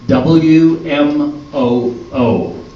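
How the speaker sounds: slow, drawn-out station call sign; call letters. Acted. Announcer opening the show, slow and drawn out. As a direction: spell out the letters like a radio jingle.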